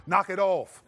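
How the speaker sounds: loud, barked knock it off; stern dad shuts the kids down; alpha male dad. Retold or imitated. A father silences kids in the car, a loud bark. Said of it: "Sometimes you got alpha male".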